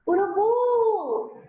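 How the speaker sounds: "Urubu" called out, last syllable high and stretched; calling out the word. Shown as a demonstration. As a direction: draw out the final U of "Urubu", like calling your mother. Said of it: "Finge que você tá lá chamando sua mãe, chama a palavra". (chamando "Urubu", com a última sílaba alongada)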